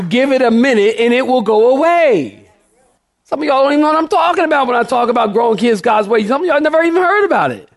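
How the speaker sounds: high, fast preacher's rant; impassioned rush to the congregation; fired-up preaching. Real, not acted. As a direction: drive it home high and quick. Preaching at full force.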